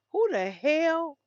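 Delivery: a stunned who-the-hell at the news; shocked outburst. Real, not acted. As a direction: blurt it out in disbelief. Shocked reaction to killer headline.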